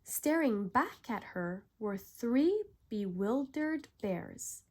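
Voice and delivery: ordinary delivery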